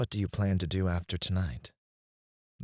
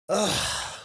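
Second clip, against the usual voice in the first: high-pitched "ugh"; short, exasperated high exhale; exasperated groan; 13 semitones higher than usual. Acted. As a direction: groan with impatience at being questioned. Boss exasperated by the question.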